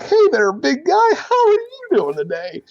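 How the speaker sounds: very high, loud strained voice; nerdy news guy voice. Acted. To Frank at Yellow Jack, very high eager nerdy voice. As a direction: greet him like an eager nerd. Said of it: "I'm like".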